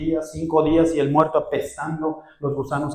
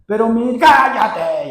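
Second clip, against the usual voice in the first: much louder, higher slow shout; angry retort; 8 semitones higher, 9 dB louder, slower than usual. Retold or imitated. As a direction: snap back in anger. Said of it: "y yo bien enojado".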